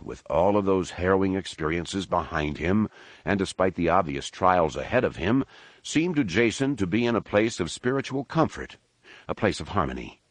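ordinary delivery

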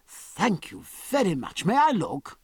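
high surprised "Oh", then grateful; astonished. A narrator's reading. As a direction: thank them as if quite taken aback.

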